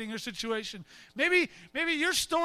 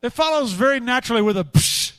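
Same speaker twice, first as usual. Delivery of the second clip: usual speaking voice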